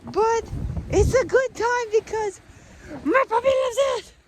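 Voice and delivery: high, loud, excited gush; squealing pet-owner enthusiasm; gushing over puppy. Real, not acted. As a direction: swing up high and loud with delight. Switches to gushing about puppy.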